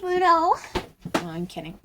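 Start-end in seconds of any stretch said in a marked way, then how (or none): 0.0-0.5 high, loud anime shout; overacted anime dub; anime character voice. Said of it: "I was trying to sound like an anime character".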